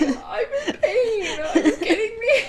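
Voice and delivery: high, laughing pained whine; whiny hurt boy. Retold or imitated. Injured boy answering his sister, high laughing whine. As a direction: complain like a hurt kid. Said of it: "He's like".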